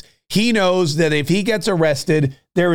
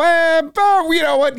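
mock exclaim; 13 semitones higher than usual. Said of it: "And then you go".

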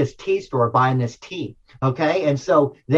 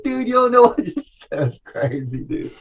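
breathless, laughing; 6 semitones higher than usual. Retold or imitated. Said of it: "I couldn't breathe".

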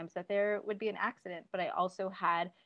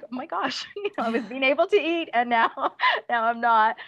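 laughing through retold dismay, higher and louder; mock dismay; 6 semitones higher, 9 dB louder than usual. Mother retelling her son's feeding setback, laughing mock dismay. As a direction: replay your exasperation, breaking into laughs. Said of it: "I was not happy".

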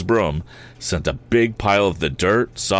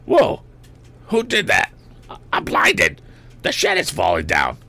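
howling in panic. A narrator's reading. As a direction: howl out blinded and scared. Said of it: "Then came a series of howls".

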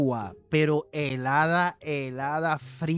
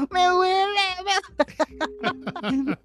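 whiny pained cry; 12 semitones higher than usual. Retold or imitated. Brother in pain on bike.

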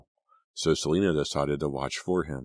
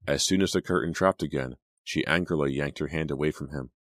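ordinary delivery

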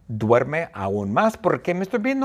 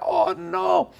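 alarmed cry. Acted. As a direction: squeal in sudden alarm. Mr Bean shouts, late. (grito agudo de alarma)

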